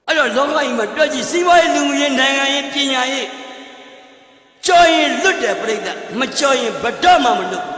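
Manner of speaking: loud, rousing oratory; forceful preaching. Acted. Speaker drives the moral home to listeners, rousing oratory. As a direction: hammer the lesson home to the crowd.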